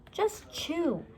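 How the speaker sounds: high, insistent hushed order. A narrator's reading. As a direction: press her to obey. Insisting near dinosaur.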